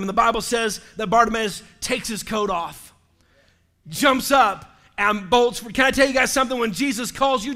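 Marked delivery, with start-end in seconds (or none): none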